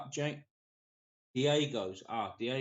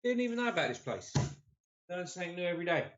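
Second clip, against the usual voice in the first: mock excitement; 6 semitones higher, much faster than usual. Mock excited, high and fast.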